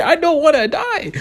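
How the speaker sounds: very high, slow comic wail; a high, drawn-out mock wail. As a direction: wail it like a terrified kid. Pleading with friends not to go.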